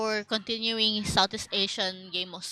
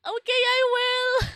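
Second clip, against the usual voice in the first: cheerful high voice; 14 semitones higher, 6 dB louder than usual. Retold or imitated. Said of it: "I'm like".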